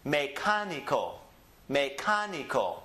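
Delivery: Mecánico with me low, CA up, ni-co back down; stress on CA. Shown as a demonstration. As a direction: lift the CA in Mecánico and let the ending fall. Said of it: "the ka is gonna be up".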